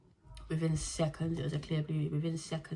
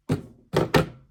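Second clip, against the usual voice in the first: loud laugh; 10 dB louder than usual. Real, not acted. Asked how she found out, loud laugh. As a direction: burst of loud unvoiced laughter.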